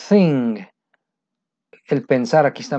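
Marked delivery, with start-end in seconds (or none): 0.1-0.6 thing starting with an unvoiced th, tongue between teeth; English th sound. Shown as a demonstration. Teacher models thing for Spanish learners. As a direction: blow air for the th in thing. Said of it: "si sacas aire solamente".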